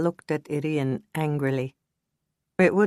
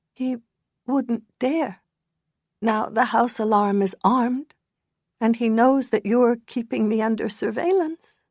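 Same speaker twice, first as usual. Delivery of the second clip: higher, brave little smile in the voice; weak smile; 7 semitones higher than usual. Acted. Injured woman reassuring the detective with a weak smile. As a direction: put on a fragile brave front. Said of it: "she said with a weak smile".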